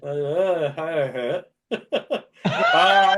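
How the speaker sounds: laughing hard while talking. Real, not acted. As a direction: crack up mid word. Cracking up at the joke.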